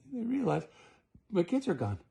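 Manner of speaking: plain reading voice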